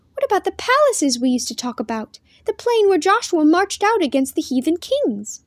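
lightly voiced girl full of eagerness; eager girl. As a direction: ask eagerly, pitch climbing. Girl eagerly asks brother.

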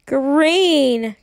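put-on a child's despairing cry at the colour; mild mock-tragic wail. A narrator's reading. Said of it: "Kirsty wailed".

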